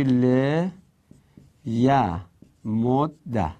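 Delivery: ل ی مد ده in separate syllables, le-ya-mod-da; merged form. Shown as a demonstration. Teacher answers own question on second case, merged form. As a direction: break up ل ی مد ده, pausing between syllables, doubled d. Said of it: "که بشه چی؟".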